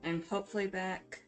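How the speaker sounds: normal talking voice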